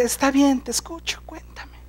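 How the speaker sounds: drops to a soft near-whisper, patient; patient hushed reply. Retold or imitated. Jesus patiently answering the woman, hushed and soft. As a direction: gently invite her to speak. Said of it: "como es muy paciente".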